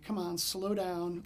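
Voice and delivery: normal talking voice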